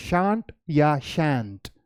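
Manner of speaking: shan't या shan't, first with a broad SHAHNT, then a flat SHANT, very slow; shan't या shan't drawled apart to set the two vowels side by side; both pronunciations of shan't. Shown as a demonstration. Teacher contrasts two ways of saying shan't for students. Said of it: "आप इसे shan't कह सकते हैं या".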